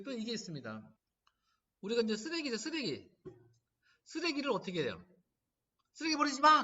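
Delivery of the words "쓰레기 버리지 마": a sharp scolding yell, higher and louder; scolding shout. Retold or imitated. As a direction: snap it like a parent telling someone off. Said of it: "그러잖아요".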